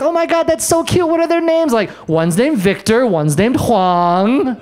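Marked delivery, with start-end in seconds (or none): 0.0-1.7 high gushing voice; gushing friend. Retold or imitated. Friends cooing about the twins, high and gushing. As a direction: coo over the twins' names. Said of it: "They're like".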